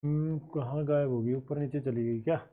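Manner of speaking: soft, low musing while searching; puzzled self-talk. Real, not acted. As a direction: ponder under your breath where it went. Still searching for missing page.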